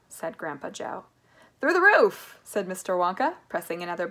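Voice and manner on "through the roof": loud, high, gleeful declaration; bold announcement. A narrator's reading. Mister Wonka answers Grandpa Joe, boldly calling out.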